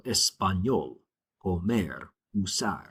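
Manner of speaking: español, comer, usar stressed on ÑOL, MER, SAR; last syllable stressed. Shown as a demonstration. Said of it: "My stress falls on the very end".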